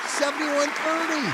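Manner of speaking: delighted shout of the total; excited announcing. Real, not acted. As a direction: call out the number like good news. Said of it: "I like that".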